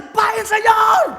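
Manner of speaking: high, stretched-out calling; shouting. Acted. Worker calling the engineer across a factory, shouting. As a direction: yell to someone who cannot hear you. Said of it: "Dia teriak lagi".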